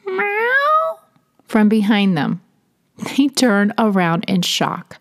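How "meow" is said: a high drawn-out cat meow; high plaintive kitty meow; imitated meow. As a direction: mew like a small cat calling from behind. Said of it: "suddenly they hear a".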